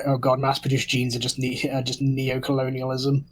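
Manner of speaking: ordinary talk, nothing marked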